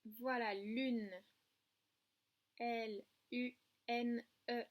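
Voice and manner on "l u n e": slow, separate letter names; letter by letter, pausing between each; spelled out. Shown as a demonstration. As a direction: spell "L U N E" one letter at a time. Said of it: "pour écrire le mot lune".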